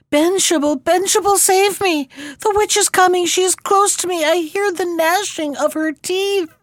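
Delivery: agonized cry. A narrator's reading. Prunella begs Benshebel to save her, terrified cry. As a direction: scream for rescue as the witch closes in. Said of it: "he heard an agonized cry from the girl".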